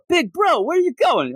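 put-on high-pitched kid-sister call; mock childlike calling out; imitated little sister. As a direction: call after big brother in a squeaky voice. Sister calls after departing brother.

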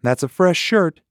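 wife calling after husband on the porch. Acted. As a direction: call it after him from the door. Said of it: "Velma called".

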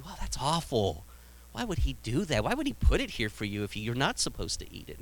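lightly voiced tempting serpent, all innocent wonder; sly snake.

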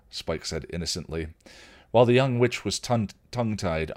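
plain reading voice

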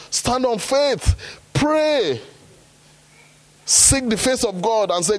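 high, driving preacher's call; fervent preaching, building to "Pray". Acted. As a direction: rouse the congregation. Preaching at full force.

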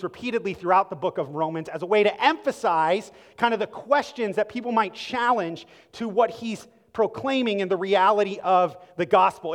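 ordinary talk, nothing marked

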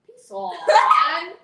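slow, loud laughing shout. Pretend. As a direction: yell it out while laughing. Put-on British, shouting.

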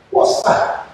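put-on a mild English accent, breathy and loud. Eagle asking its chicken friend, in a light English accent. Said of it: "it spoke with an English accent".

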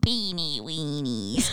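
low, slow creepy creature voice. Shown as a demonstration. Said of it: "type voice".

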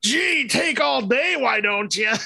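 high, slow whiny sarcasm; impatient Pee Wee Herman. Retold or imitated. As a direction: huff it like a fed-up kid. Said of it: "He's all like".

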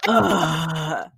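long, drawn-out "ugh" of annoyance; retold groan of exasperation; exasperated groan. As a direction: groan like you're fed up with your husband. Groans at husband's challenge.

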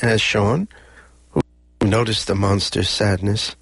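ordinary delivery, nothing marked

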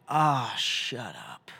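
bitter retort. Acted. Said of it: "And you want to say".

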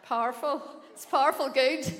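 loud, proud Irish "Powerful", "powerful good". Shown as a demonstration. Irish pastor teaching the church to say it, loud. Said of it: "I think you say powerful".

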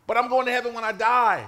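ordinary talk, nothing marked

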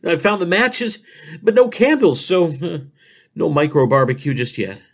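ordinary talk

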